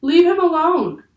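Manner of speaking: high, loud protective cry; yelled demand. A narrator's reading. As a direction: shout it in protest. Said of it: "yelled Annie".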